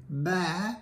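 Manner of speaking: bleating like a goat; a little goat bleat. Acted. Priest teaching the wife to bleat, mock bleat. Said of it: "you can say".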